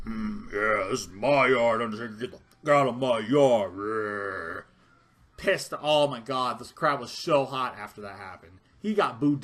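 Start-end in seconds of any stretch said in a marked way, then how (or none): 0.1-4.6 louder, low tough-guy taunt in a changed voice; mock Reigns taunt. Fan mocking Reigns telling Undertaker to leave, gruff and loud. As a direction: sneer it like a cocky wrestler. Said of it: "he had his like".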